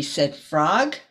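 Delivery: usual speaking voice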